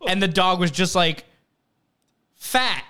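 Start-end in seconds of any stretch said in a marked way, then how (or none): 2.3-2.8 pitched-up one-word dog verdict; higher, mock blunt announcement; blunt button dog. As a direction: imitate a dog's word button. Dog's verdict via button.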